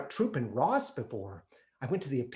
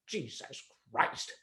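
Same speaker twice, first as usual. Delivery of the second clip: disgusted mutter; 8 dB quieter, slower than usual. Retold or imitated. Father muttering at game.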